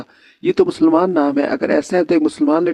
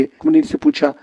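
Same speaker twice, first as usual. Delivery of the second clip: ordinary delivery, nothing marked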